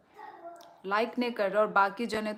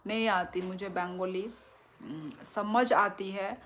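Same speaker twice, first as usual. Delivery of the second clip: usual speaking voice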